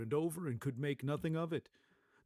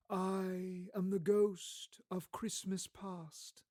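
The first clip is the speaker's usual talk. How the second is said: ghostly spirit; 6 semitones higher, slower than usual. Acted. Ghost of Christmas Past.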